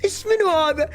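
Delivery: high cooing voice for a cute creature; baby talk. Acted. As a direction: coo at it like a baby. Coos at cute game creature.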